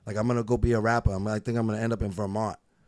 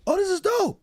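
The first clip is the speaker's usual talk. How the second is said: much higher, louder mock praise; impressed listener; 4 dB louder than usual. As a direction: gush over it in a high voice. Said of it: "they're like".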